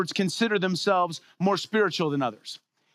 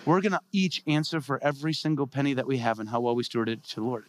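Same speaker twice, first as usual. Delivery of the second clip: ordinary delivery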